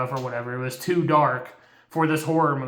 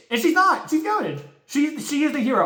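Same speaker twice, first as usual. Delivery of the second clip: much higher, excited insistence; passionate defense; 10 semitones higher than usual. Real, not acted. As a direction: cheer her on like a hero.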